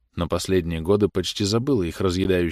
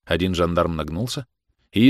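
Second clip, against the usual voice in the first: usual speaking voice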